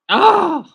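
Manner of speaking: loud, high "ah" of frustration; frustrated groan. Real, not acted. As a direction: blurt out an exasperated cry. Frustrated at forgetting the word.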